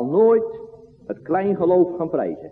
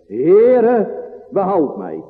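loud cry; 3 semitones higher, 4 dB louder, much slower than usual. Acted. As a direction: call out Heere as a man drowning. Peter cries out sinking.

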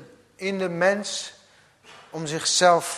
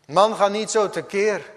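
[name] calling out; 6 semitones higher, 7 dB louder than usual. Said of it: "riep hij".

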